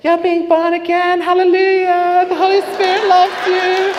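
very high, loud, jubilant woman impression; ecstatic mock rejoicing. Praying woman to the new convert, ecstatic high imitation. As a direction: rejoice shrilly like a zealous believer.

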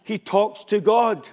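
usual speaking voice

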